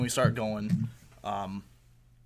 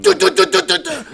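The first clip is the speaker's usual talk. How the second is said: a rapid, much higher and louder du-du-du like cannons; imitated cannon fire; 13 dB louder than usual. Telling friends how the wrecked car sounded, with loud cannon noises. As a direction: blast out the cannon noise through a laugh. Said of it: "sounds like artillery cannons going off".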